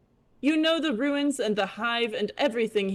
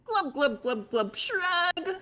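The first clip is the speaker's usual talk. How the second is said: fish glub shrug; 9 semitones higher, slower than usual. Acted. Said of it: "glubbing in fish voice".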